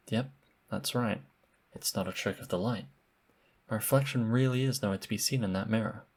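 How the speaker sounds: normal talking voice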